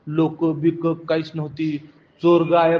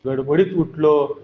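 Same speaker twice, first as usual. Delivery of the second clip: plain reading voice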